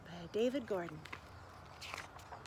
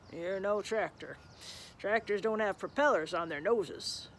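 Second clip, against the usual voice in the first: gruff tractor. Acted. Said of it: "said the tractor".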